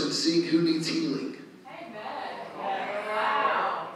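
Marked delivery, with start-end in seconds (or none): none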